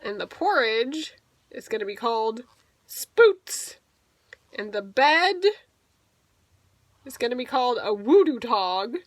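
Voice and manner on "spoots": squeaky high alien word spoots. Shown as a demonstration. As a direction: pop out spoots high and loud like an alien term.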